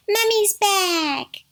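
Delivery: put-on high, slow, drawn-out kid's shout; excited shout. A narrator's reading. Smallest kid announces mother's return, shouting. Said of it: "shouted the smallest kid".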